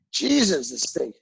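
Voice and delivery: ordinary talk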